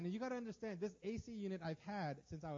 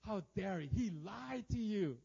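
acted outrage, dragging out the accusation; stretched, offended mock protest; rising indignation; 4 dB louder, slower than usual. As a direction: stir up the grievance. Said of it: "I can feel my flesh rising".

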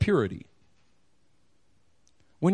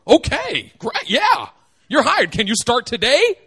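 higher, louder eager employer; eager boss; 4 semitones higher, 6 dB louder than usual. Retold or imitated. Boss hiring someone who asked too little, eager and loud. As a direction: snap up the cheap offer excitedly. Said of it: "They say".

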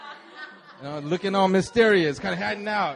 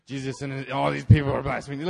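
seething, breathy low threat; breathing threats; 6 semitones lower than usual. Retold or imitated. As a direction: spit it through heavy breaths. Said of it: "he's breathing threats".

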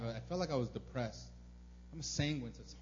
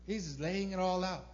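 usual speaking voice

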